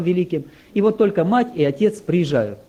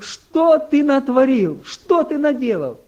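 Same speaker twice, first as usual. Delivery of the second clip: higher, louder, slow horrified cry of a mother; alarmed mother; 6 semitones higher, 4 dB louder, slower than usual. Retold or imitated. Mother hears her son is arrested, horrified and slow. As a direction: drag out each word in shock.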